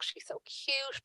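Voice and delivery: hushed, slow and breathy, almost whispered; slow soft whisper of delight; soft gushing. Retold or imitated. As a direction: coo it softly like it's adorable. Girl pleading with her mother.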